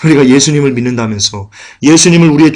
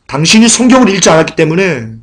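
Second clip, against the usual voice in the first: ordinary talk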